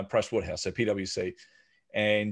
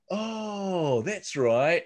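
co-founder voice; 8 semitones higher, much slower than usual. Retold or imitated. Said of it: "And he goes".

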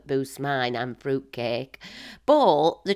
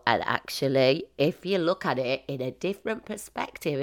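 ordinary talk

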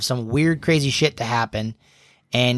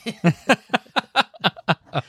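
high laugh; 8 semitones higher than usual. Real, not acted. Laugh after the impression.

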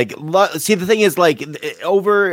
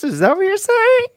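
mock outrage. Mock outrage at tea insult.

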